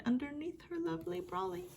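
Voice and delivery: a hint of a sing-song little Mai; lilting. A narrator's reading. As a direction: skip through it happily. Little Mai stays dry.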